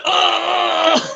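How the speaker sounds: high, drawn-out "ahh" in imitation; high put-on wail; mock moan. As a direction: hold a high mock cry, imitating the guy. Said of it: "he's just like".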